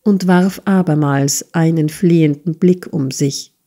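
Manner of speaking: plain reading voice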